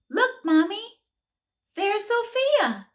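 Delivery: put-on high, loud giddy child voice; giddy little girl. A narrator's reading. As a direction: bubble over like an excited little girl. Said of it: "Jenny said giddily".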